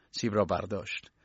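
plain reading voice

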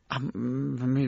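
usual speaking voice

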